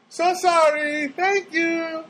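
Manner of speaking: high, sing-song imitation of the clerk; mocking phone clerk. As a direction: ape her polite line way up high.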